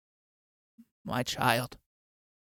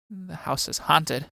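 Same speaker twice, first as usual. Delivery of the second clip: ordinary talk, nothing marked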